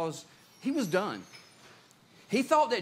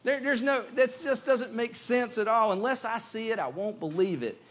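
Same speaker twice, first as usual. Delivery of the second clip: very high, loud incredulous protest, easing down at the end; disbelieving Thomas; 7 semitones higher, 4 dB louder than usual. Acted. Thomas answering the disciples' claim, hammed-up disbelief. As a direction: scoff in a raised, acted voice. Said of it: "Thomas still didn't believe".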